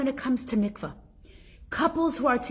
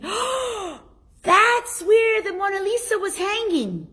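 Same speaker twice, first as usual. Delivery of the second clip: much higher, louder mock-amazed voice; amazed visitor; 11 semitones higher, 4 dB louder than usual. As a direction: play a wide-eyed museum visitor. Imitating visitors at empty spot.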